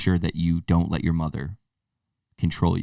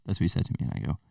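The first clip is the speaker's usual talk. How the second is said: ordinary talk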